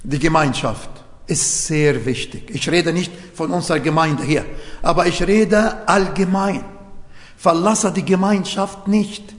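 normal talking voice

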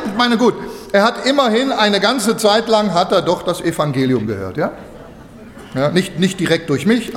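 normal talking voice

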